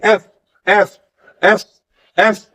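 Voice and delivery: teacher drawing out the friction of "एफ"; F sound demo. Shown as a demonstration. Teacher showing students the F sound, air hissing through teeth. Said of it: "ऊपर वाला दांत lower वाले होंठ पे रखे".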